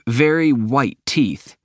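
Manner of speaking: plain reading voice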